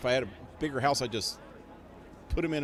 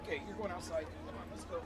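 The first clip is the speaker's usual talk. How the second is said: much softer, fading to a whisper; quiet to the dogs; 4 semitones higher, 11 dB quieter, much faster than usual. Real, not acted. Owner leading dogs outside, soft hushed voice. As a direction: hush them out the door.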